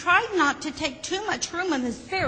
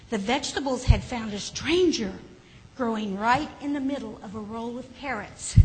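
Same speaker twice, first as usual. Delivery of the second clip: ordinary delivery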